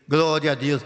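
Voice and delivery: normal talking voice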